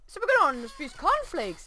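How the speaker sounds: usual speaking voice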